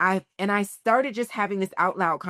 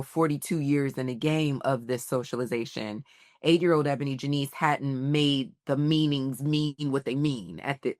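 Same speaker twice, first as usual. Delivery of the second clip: ordinary talk, nothing marked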